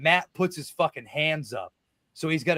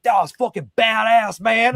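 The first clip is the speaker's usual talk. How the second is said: loud, raised hype voice; shouted praise; 8 semitones higher, 7 dB louder than usual. Retold or imitated. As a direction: shout it as a fired-up compliment. Retold praise from wrestler mid-match.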